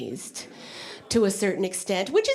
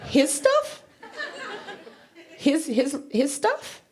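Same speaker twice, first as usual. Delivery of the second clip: much higher, rising disbelief; incredulous; 7 semitones higher, slower than usual. Real, not acted. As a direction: echo it back in shock. Stunned at surprise request.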